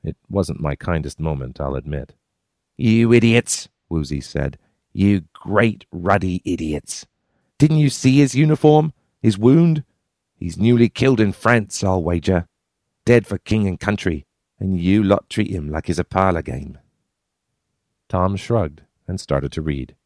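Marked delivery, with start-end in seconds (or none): 2.8-3.7 a Cockney man, scornful and loud; Cockney accent. A narrator's reading. Woozy scolding the others in a Cockney accent. 4.9-16.8 Cockney rebuke. A narrator's reading. Woozy scolding them in Cockney for mocking the dead soldier.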